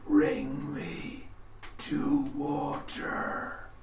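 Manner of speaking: high rasp sinking into a slow whisper; raspy corpse plea. Acted. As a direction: start high and cracked, then breathe the rest out. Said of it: "in this raspy, horrible voice".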